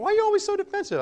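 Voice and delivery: wife teasing her husband about being defensive, high mock voice; imitating wife. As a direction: squeak out her complaint as a playful imitation. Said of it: "Angel's like".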